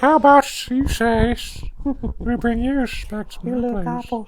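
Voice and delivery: high slow leering voice; sleazy old man invitation; creepy old man. Pretend. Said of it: "You're so good at it".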